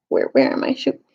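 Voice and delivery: a low unvoiced mutter; muttered to self. Real, not acted. Reader losing the place in the book, muttering. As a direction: mutter while finding the place. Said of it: "reader loses place, mutters".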